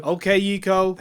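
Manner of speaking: exaggerated American voice command; American accent. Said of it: "talk in a very specific American accent".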